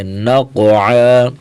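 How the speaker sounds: نقع۔ said very slowly, with the throat sound of عین at the end; clear ain sound. Shown as a demonstration. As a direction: land firmly on the عین of نقع۔. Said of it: "عین کی آواز نکالیں گے". (نقع۔ میں عین کی واضح آواز)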